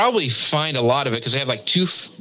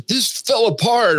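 high, slow mock wail; mock dismay; 11 semitones higher, slower than usual. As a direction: whine it like a failed baker. Quoting a complaining comment.

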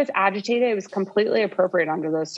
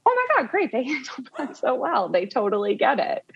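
relieved delight; 5 semitones higher than usual. Retold or imitated. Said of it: "And I was like".

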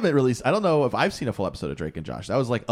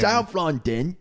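high South London accent, rising at the end; 6 semitones higher, much slower than usual. Shown as a demonstration. Co-host echoing the accent attempt, questioning South London accent.

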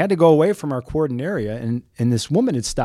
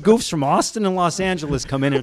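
raised pitch, comic territorial outburst; indignant South Sider; 6 semitones higher than usual. Acted. South Sider griping about outsiders in his park, mock indignant. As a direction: stake your claim like a riled-up local. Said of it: "this is where the South Sider in me comes out".

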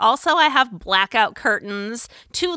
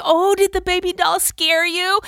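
soothing mom; 9 semitones higher, 4 dB louder than usual. Retold or imitated. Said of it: "I'm like".

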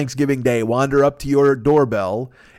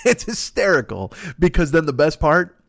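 ordinary talk, nothing marked

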